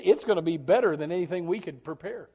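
usual speaking voice

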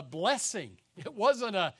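normal talking voice

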